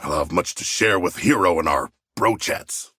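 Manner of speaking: ordinary talk, nothing marked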